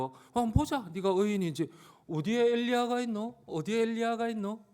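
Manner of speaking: taunting, pitch climbing toward the end; mocking. Mockers taunting Jesus on the cross, mocking. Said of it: "주님을 놀리고 있어요".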